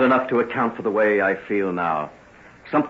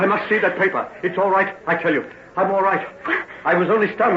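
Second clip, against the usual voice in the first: urgent insistence; 9 semitones higher, 4 dB louder than usual. Acted.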